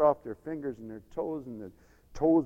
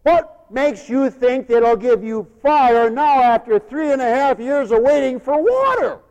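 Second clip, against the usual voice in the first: loud, slow, high shout; taunting challenge; 10 semitones higher, 15 dB louder than usual. Acted. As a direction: throw the challenge out at full force.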